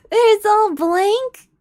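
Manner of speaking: high slow laughing words; laughing disbelief. Real, not acted. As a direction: giggle it out in disbelief. Laughing at the blank page.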